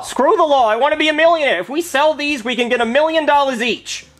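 suddenly thrilled about money; excited. Acted. Cop suddenly greedy for millions.

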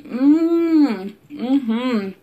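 yummy hum. Real, not acted. Tasting food.

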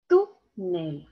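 "Túnel" split as TÚ... nel, weight on TÚ; stress on first syllable. Shown as a demonstration. Teacher confirming the answer, "Túnel" broken into stressed TÚ, low nel. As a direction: punch TÚ in "Túnel", then drop on nel. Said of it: "Aquí está la mayor fuerza de voz".

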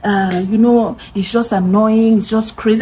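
slow, sheepish mock apology; lightly voiced guy backing down; slow hint of a sorry friend; mimicking an apologetic friend. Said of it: "the boy is apologizing".

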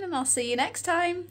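ordinary talk, nothing marked

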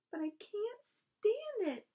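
high, loud, drawn-out wail; anguished shout. A narrator's reading. Wilbur refuses to lose Charlotte, anguished shout. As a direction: shout it slowly, stretched with anguish. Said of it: "shouted Wilbur".

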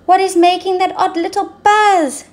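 high, bright questioning voice; curious zebra. Acted. Zigzag Zebra asking the gazelles, high curious voice. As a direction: ask like a shy little zebra. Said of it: "said Zigzag Zebra".

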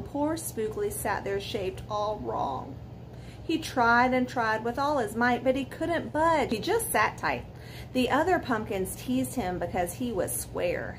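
ordinary delivery, nothing marked

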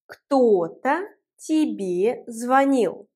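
кто-то тебе звонил, spoken slowly and clearly for learners; slow teacher model, word by word; slow, careful teaching demo; slow model sentence. Shown as a demonstration. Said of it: "teacher repeats the example slowly".

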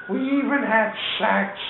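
high put-on plaintive cry; mock lament. Charlie by the car repeats himself, high mock lament. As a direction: wail it in his imitated high voice.

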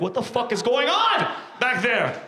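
exasperated rising shout, drawn out; acted outburst. Customer yelling toward the back of the burger place, exasperated. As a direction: holler it in mock outrage.

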